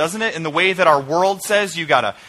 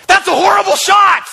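high, loud yell at a friend; mock heckling shout; 8 dB louder than usual. Golfer to friend mid-swing, mock heckling shout. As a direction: holler it out to rattle his swing.

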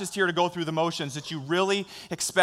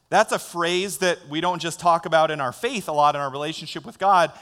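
normal talking voice

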